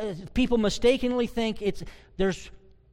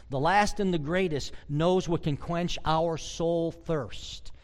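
normal talking voice

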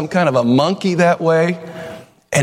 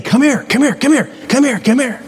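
sin's enticing call; 10 semitones higher than usual. Acted. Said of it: "that sin is saying".

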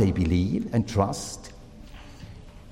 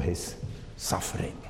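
executed whispered, much softer; 7 semitones lower, 15 dB quieter than usual. Acted. Preacher recounting Jesus' death, hushed whisper. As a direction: breathe executed out in a hush. Dramatic end of the Passion sentence.

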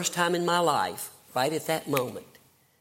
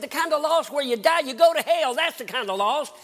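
higher, louder desperate cry; 9 semitones higher, 4 dB louder than usual. Retold or imitated. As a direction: blurt the terror out. Desperate retold answer to roommate.

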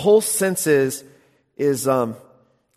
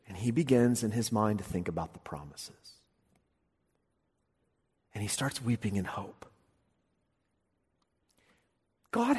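soft sermon storytelling; 3 semitones lower, 4 dB quieter than usual. Acted. Preacher recounting Abraham weeping in hope, soft and hushed. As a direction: draw them in quietly. Tender retelling of mourning.

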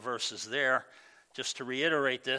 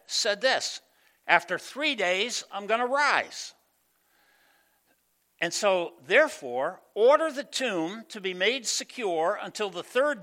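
voicing the priests; 4 semitones higher, 6 dB louder than usual. Preacher voices priests and Pilate.

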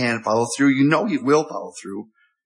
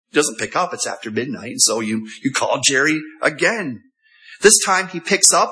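usual speaking voice

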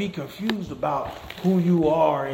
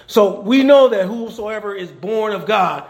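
slow, loud preaching build; preaching emphatically; 4 semitones higher, 7 dB louder than usual. Acted. Preacher declaring to the church, slow and loud. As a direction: preach it out to the congregation.

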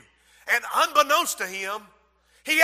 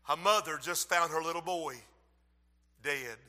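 hushed grim news; 7 semitones lower, 12 dB quieter than usual. Acted. Father reports a death.

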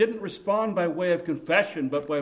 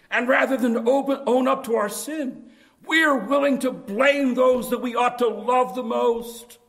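higher, louder impassioned preaching; preaching at full force; 8 semitones higher, 7 dB louder than usual. Acted. Preacher warning his flock about sin, impassioned and loud. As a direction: drive the warning home to the congregation.

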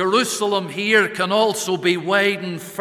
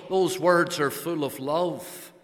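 ordinary talk, nothing marked

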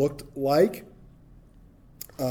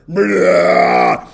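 bear roar; 9 semitones lower, 12 dB louder than usual. Acted. Bears attack the youths.

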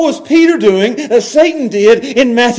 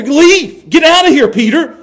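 higher, louder rebuke to Peter; hard dismissal; 8 semitones higher, 5 dB louder than usual. Retold or imitated. Jesus rebuking Peter, loud sharp dismissal. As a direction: drive him off sharply. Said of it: "That's what he's saying here".

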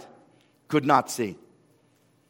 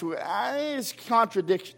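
mock skeptic; 6 semitones higher, 4 dB quieter, slower than usual. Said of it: "sometimes skeptics".

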